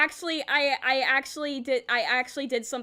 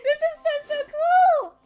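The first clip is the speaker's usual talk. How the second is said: high, loud fangirl squeal; excited; 7 dB louder than usual. Real, not acted. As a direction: squeal it out, starstruck. Starstruck by guest.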